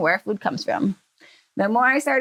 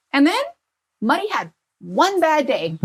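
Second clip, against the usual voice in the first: higher, building dad voice; dad's punchline; 6 semitones higher than usual. Retold or imitated. Dad telling his daughter the pig's fate, higher, building.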